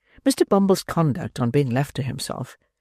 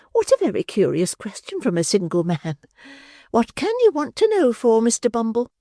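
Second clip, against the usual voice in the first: coy matron; 8 semitones higher than usual. Acted. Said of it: "exclaimed the matron".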